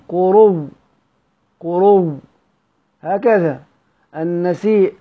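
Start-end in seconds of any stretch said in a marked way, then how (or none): 0.1-2.2 قرو said with the hamza turned to a doubled waw; pausal Hamza reading. Shown as a demonstration. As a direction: say قرو ending in a stressed doubled w. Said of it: "واو بعد واو تدغم". (قرو بإبدال الهمزة واوا وإدغامها)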